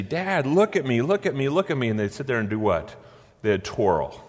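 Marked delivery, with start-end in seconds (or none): 0.0-1.9 higher, eager little-girl voice, lightly imitated; little daughter calling. Daughters in princess dresses calling to dad, a light little-girl imitation. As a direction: playfully imitate a small girl begging for attention. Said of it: "and they'd say".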